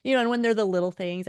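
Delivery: usual speaking voice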